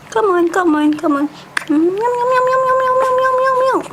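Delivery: high sing-song coaxing. Real, not acted. Coaxing baby to eat.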